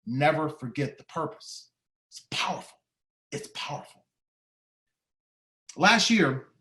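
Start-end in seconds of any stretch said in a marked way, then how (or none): 2.1-2.8 whispered, breathy emphasis; hushed whisper. Real, not acted. Speaker whispers key phrase to audience, hushed. Quiet repeat of key line. 3.3-4.0 barely audible whispered echo; fainter whisper. Real, not acted. Echoing his own words to the audience, barely whispered. Quiet repeat of key line.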